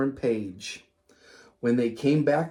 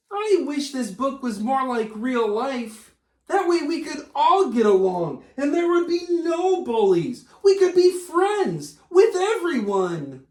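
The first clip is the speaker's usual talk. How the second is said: chirpy little puppet voice; 8 semitones higher than usual. Acted. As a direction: puppet Fred gushing to the host. Puppet Fred keeps talking.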